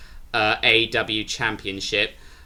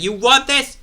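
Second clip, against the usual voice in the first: very high, strained mock outburst; high-pitched retold angry taunt; imitated fury; 13 semitones higher, 4 dB louder than usual. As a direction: act out a furious wrestler's challenge, pitch shooting up. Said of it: "he was furious".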